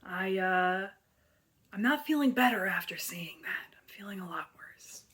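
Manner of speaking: hushed, breathy whisper after crying; barely-voiced confession. Real, not acted. Post-cry whisper to camera.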